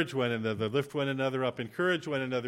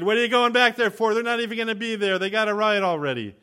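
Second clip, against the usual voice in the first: high, loud, hurried yelling; exasperated protest; 9 semitones higher, 9 dB louder than usual. Retold or imitated. Passenger protesting as the driver turns the van back, exasperated yell. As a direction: yell it in retold exasperation, rushing on. Objects to the U-turn.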